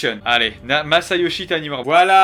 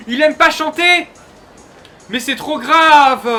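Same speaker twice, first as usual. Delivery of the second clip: outraged; 9 semitones higher, 7 dB louder, slower than usual. Real, not acted. Outraged at character.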